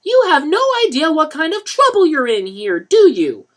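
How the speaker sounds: lecturing. A narrator's reading. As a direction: lecture him like a mother. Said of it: "Claudette lectured".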